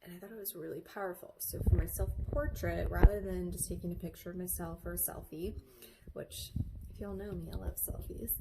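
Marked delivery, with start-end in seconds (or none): none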